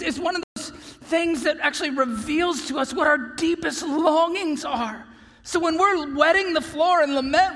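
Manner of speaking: high, loud, swelling pulpit cry; impassioned preaching. Real, not acted. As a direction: build with fervour, ringing out. Preaching at full force.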